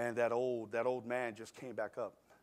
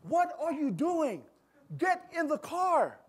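put-on high, slow, loud voice of a frightened young daughter; a teenage girl begging her dad to stop; daughter pleading; 13 semitones higher, 7 dB louder, slower than usual. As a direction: plead from the back seat, pitched up and drawn out. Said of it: "And she says".

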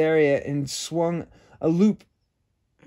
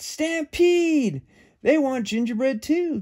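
cowboys yelling; 11 semitones higher, slower than usual. A narrator's reading. The cowboys shout about the stampede, high alarm. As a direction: yell a warning. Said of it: "The cowboys said".

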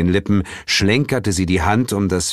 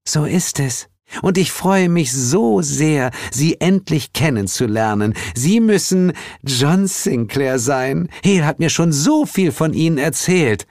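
sweet, effusive hostess; gracious spring goddess; 9 semitones higher than usual. A narrator's reading. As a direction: coo with joy at meeting a guest.

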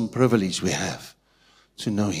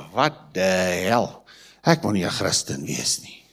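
scornful worldly onlooker; 6 semitones lower, 4 dB quieter than usual. Retold or imitated. The world mocking miserable Christians.

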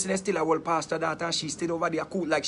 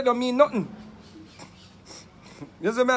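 usual speaking voice

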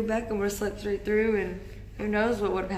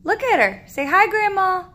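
sing-song prompting; 11 semitones higher, 12 dB louder than usual. Real, not acted. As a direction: coax the little one to wave hi to Grandma. Mom prompting toddler on video call.

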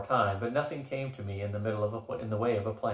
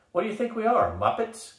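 much higher, loud indignant snarl; 12 semitones higher, 4 dB louder than usual. Acted. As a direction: scoff in mock outrage. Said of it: "Gus snarled".

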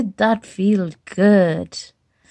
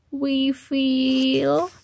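higher whine at the end; itchy whine; 4 semitones higher, much slower than usual. Acted. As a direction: confess the itch desperately. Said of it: "Do you feel okay".

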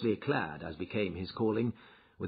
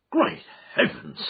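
shocked exclamation, much higher and louder; astonished cry; 6 dB louder than usual. A narrator's reading. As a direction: cry out in disbelief. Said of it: "cried the inspector".

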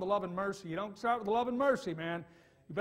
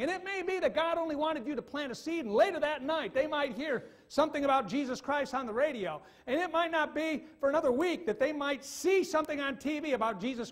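loud, raised preaching voice; fiery preaching; 6 semitones higher, 5 dB louder than usual. Acted. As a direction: preach it hard to the crowd. Preaching at full force.

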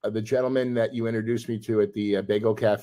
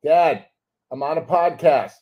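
higher, raised-voice order to dad; exasperated shooing; 4 semitones higher, 4 dB louder than usual. Real, not acted. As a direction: shoo dad out of the room, voice raised. Shoos dad out mid-podcast.

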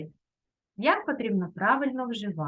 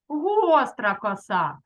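гостра коса повільно, з наголосом на гостра; прочитати гостра коса розтягнуто, наголошуючи перше слово; повільно, чітко; slower than usual. Shown as a demonstration. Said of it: "гостра коса, якою будуть косити". (повільно й виразно)

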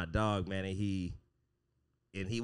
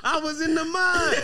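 higher, louder mock wail through laughter; hammed-up lament; 6 dB louder than usual. Telling friends about his Ethiopian friend, mock anguished wail. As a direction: wail it like a war veteran, laughing.

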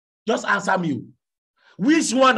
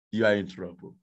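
softer and much lower, ominous; lowered, hushed "You are in trouble"; low, quiet warning; 10 semitones lower, 6 dB quieter than usual. Acted. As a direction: drop the voice to a threat. Repeated warning.

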